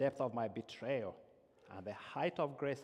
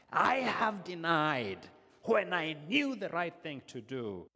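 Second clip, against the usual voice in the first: loud, slow, higher confessional prayer; fervent prayer; 3 semitones higher, 6 dB louder, slower than usual. Acted. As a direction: pour out the confession with full force. Confessional prayer over music.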